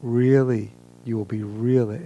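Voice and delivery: ordinary delivery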